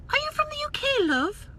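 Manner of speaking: put-on British accent in a high, teasing voice; mock British. Vlogger teasing a viewer in a mock British accent.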